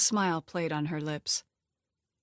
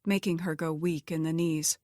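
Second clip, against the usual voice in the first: plain reading voice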